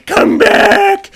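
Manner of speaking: very high, loud dragon shriek; hammed-up desperate monster yell; pleading dragon. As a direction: screech after someone running away. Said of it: "she's trying to get him".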